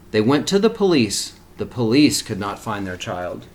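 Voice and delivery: put-on reading a picture book aloud to children; steady, expressive storybook reading; story narration. A narrator's reading.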